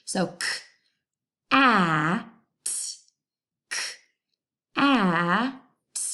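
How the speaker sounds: k, a, t said sound by sound. Shown as a demonstration. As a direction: sound out k, a, t with pauses. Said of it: "the middle vowel sound".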